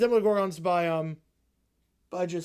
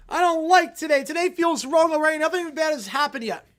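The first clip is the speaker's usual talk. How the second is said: put-on fussy kid; 5 semitones higher, 6 dB louder than usual. Young Sheldon as coach.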